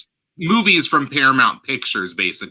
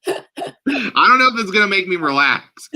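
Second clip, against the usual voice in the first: ordinary talk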